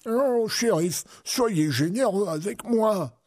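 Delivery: put-on high, slow pleading old man; drawn-out, high-pitched entreaty; imploring. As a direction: beg pitifully in an old man's voice. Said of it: "implora Cornio".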